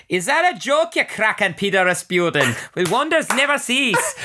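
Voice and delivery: hammed-up Irish accent with a high lilt. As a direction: put on a playful Irish brogue. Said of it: "Now I'm doing accents".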